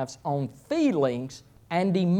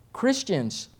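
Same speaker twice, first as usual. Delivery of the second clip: ordinary talk, nothing marked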